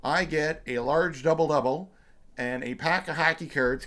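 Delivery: normal talking voice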